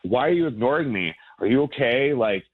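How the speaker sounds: ordinary talk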